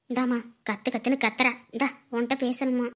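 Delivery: low, soft voice off the phone; hushed aside. Acted. Grandparent quietly offers the child the phone, hushed. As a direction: keep it down so the caller can't hear.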